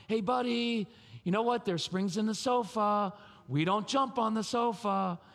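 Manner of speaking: high, lilting coaxing parent voice; mock a parent coaxing a small child; over-gentle parent. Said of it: "you don't have to do the we thing".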